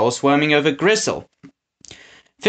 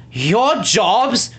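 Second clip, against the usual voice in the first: shouting; 7 semitones higher than usual. A narrator's reading. Winnie to the henchmen, indignant shout. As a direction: fire the question back in disbelief. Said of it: "Winnie shouted".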